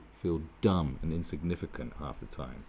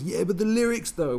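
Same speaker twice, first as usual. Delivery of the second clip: high, whiny objection; mock snob pitched way up; whiny lyricist; 10 semitones higher, 6 dB louder than usual. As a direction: play a precious lyricist protesting. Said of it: "a lyricist was".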